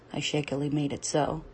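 ordinary talk, nothing marked

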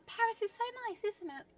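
forced brightness; fake cheer. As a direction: sound breezy while panicking.

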